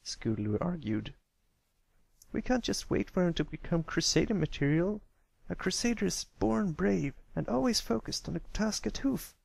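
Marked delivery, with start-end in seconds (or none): 2.3-9.3 bold filly voice pitched higher, lecturing her friend; a young tomboy filly laying down the rules, higher; Scootaloo's voice. Acted. Filly insists to her friends on Crusader bravery, voiced higher.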